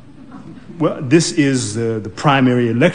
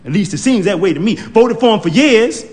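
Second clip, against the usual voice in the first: usual speaking voice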